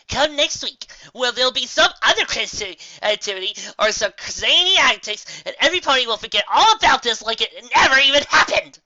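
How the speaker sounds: big breezy dismissal; loud and dismissive. A narrator's reading. Trixie dismissing, voice rising.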